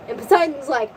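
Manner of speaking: usual speaking voice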